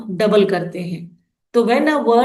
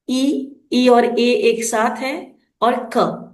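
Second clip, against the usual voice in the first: normal talking voice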